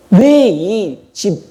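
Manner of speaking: put-on slow, whining older brother; drawn-out complaint. Older brother protesting to his father, slow whine. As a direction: stretch it out like a sulking son.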